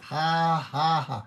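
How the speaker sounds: ordinary delivery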